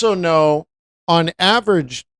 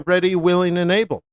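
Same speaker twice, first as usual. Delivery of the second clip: ordinary delivery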